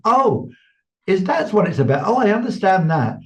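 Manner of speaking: high excited "Oh", lightly imitated woman; sudden realization. The other woman in the audience realizing, mock excited.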